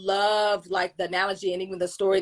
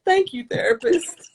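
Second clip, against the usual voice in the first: high, squeaky put-on weepy thanks; mock tearful; 12 semitones higher than usual. Client thanking her therapist, mock tearful. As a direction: imitate grateful blubbering. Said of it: "I was, like, crying".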